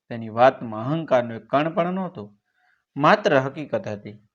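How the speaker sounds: plain reading voice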